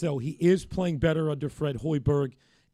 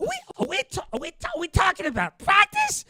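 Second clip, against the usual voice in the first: high-pitched incredulous rant building to a shout; exasperated athlete impression, stammering up into a loud squeal; Allen Iverson; 13 semitones higher, 4 dB louder than usual. Host mimics star's practice press conference, incredulous impression. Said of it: "pretty good Allen Iverson impression".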